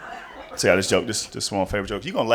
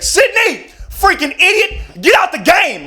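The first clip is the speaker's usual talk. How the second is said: high, loud furious shout; angry coach yell; 13 semitones higher, 6 dB louder, slower than usual. Retold or imitated. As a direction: chew out the player from the sideline. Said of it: "He was like".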